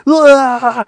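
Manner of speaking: loud, high mock scream of pain; imitated scream of pain. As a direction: yell like a mouth on fire. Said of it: "He was kinda screaming".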